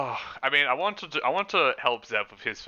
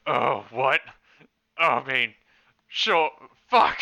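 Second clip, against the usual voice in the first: raised, louder startled outburst; flustered reply; 3 semitones higher, 6 dB louder, slower than usual. Acted. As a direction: blurt it out in character, rising in pitch and volume. Player voices Walter's startled reply.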